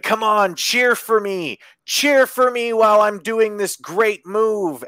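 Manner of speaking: mock plea to the crowd, high and loud; imitated crowd-baiting wrestler. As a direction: play a showboating wrestler begging for cheers, drawn out. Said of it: "he went like this".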